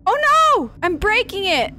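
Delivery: a sudden high, loud alarmed cry. Real, not acted. Fears breaking the sub.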